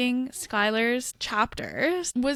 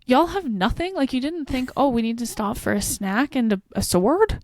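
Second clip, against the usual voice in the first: loud, raised-pitch incredulous scolding; exasperated, pitched-up questions; mock exasperation; 6 dB louder than usual. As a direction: scold them in playful disbelief. Retold exasperation at unarmed characters.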